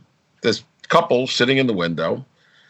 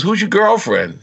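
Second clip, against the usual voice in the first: surprised question; 8 semitones higher than usual. Retold or imitated. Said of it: "I says".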